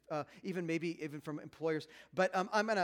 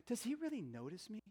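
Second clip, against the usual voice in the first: soft wistful question; 3 semitones higher, 8 dB quieter than usual. Acted. Voicing wives' longing.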